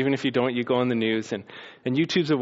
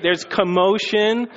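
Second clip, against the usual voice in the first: loud, high, driving sermon voice; excited preacher describing the temple uproar; full-force preaching; 9 semitones higher, 7 dB louder than usual. Acted. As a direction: paint the chaos at full volume.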